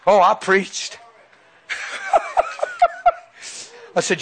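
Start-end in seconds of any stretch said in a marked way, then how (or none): 1.7-3.1 real high-pitched laugh. Real, not acted. Preacher after recalling how he preached to son, high laugh.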